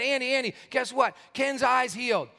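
high, eager retold announcement; excited news-breaking. Pounding pastor's door about healed eye, excited. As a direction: burst out with amazing news.

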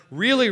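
"really" hit hard and loud; stress on really. Retold or imitated. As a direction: stress "really" as an underlined word. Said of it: "see how it's underlined".

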